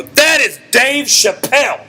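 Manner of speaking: loud, high, stretched-out exclamation; excited recognition. Retold or imitated. Comic tells of spotting Dave Chappelle by voice, excited shout. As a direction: blurt it out, emphatic and high.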